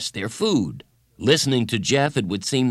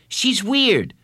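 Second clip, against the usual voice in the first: high, louder dismissive verdict; scornful complaint; 12 semitones higher, 5 dB louder than usual. A narrator's reading. Boy to friend about the counselor, scornful. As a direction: put some force into the scorn.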